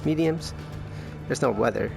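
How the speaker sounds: normal talking voice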